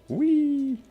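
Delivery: very high, gleeful whoop; delighted high-pitched whee; playful whee. Real, not acted. Zooming in with photo mode.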